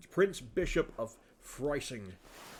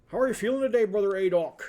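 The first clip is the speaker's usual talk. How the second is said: much higher and louder, sing-song hello; over-bright hello to a grumpy old monk; hammed-up cheery greeting; 8 semitones higher, 9 dB louder, faster than usual. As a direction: greet him like an overeager friend. Player voices Andreas greeting monk.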